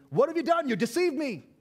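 high, loud cry of betrayal; outraged accusation. Acted. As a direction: act out the cheated man's outrage. Acts out Jacob's angry accusation.

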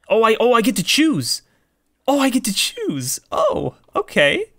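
high, loud excited outburst; delighted surprise. Real, not acted. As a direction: burst out thrilled at getting a choice. Delighted to get a choice.

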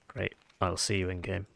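ordinary talk, nothing marked

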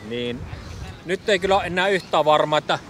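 normal talking voice

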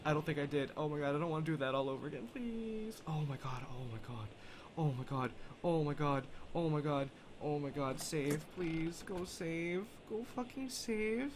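shaky relieved murmur. Real, not acted. Relieved after escape, breathless.

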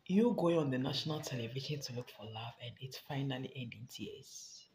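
ordinary talk

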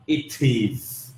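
it is linked smoothly and slowly. Shown as a demonstration. Teacher demos linking it is, slowly. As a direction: glide it into is. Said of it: "nó sẽ liền nhá".

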